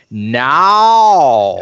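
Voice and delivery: loud, drawn-out Southern vowel, swelling up and over; thick Southern accent. Retold or imitated. Co-host imitating how he sounds on the podcast, thick Southern accent. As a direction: stretch NOW out in an exaggerated Southern accent. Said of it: "hear myself on here going like".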